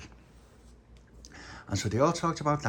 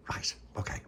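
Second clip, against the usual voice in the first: whisper; 8 dB quieter than usual. Acted. Pauline agrees quietly.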